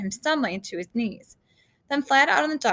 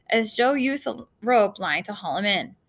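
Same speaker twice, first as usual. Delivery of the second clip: ordinary delivery, nothing marked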